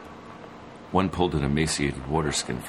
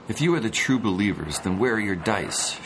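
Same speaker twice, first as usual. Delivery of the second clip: a higher, louder challenge with stress on you; challenging; 6 semitones higher, 4 dB louder than usual. Acted. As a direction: throw the question back at him. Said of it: "she challenged".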